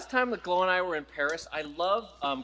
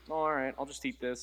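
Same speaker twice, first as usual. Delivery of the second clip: meek resignation; 4 semitones lower, 7 dB quieter than usual. Retold or imitated. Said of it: "I'd just be like".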